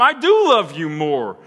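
loud, high, emphatic avowals, dropping at the end; boastful Peter. Acted. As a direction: proclaim supreme loyalty in front of everyone. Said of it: "you told me in front of them".

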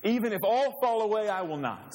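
high, loud, eager protest; impetuous disciple declaring he will never fall; Peter's bold vow. Acted. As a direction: Peter blurting out his loyalty. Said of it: "Peter declared".

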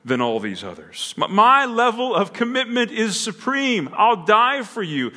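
loud, high, emphatic avowals, dropping at the end; boastful Peter. Acted. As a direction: proclaim supreme loyalty in front of everyone. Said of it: "you told me in front of them".

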